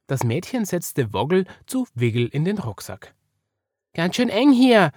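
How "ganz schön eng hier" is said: high, loud squeaky complaint; put-out small character, pitched up; griping. A narrator's reading. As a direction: complain as a miffed little bunny. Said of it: "mokierte sich Wiggle darüber".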